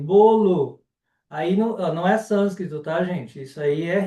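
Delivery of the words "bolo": bolo in two clear syllables, louder; louder bolo, sounding the O after the L; variant with final vowel. Shown as a demonstration. Teacher accepts the student's variant, final vowel sounded. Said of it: "a gente ouve bolo também".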